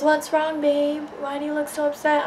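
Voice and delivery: lightly mocking, high boyfriend voice; mock concerned boyfriend. Mimics boyfriend's concerned question.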